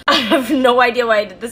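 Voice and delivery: loud, laughing confession; real amused laughter. Real, not acted. Admitting she posed as experienced, laughing loudly. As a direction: laugh through it at your own silliness.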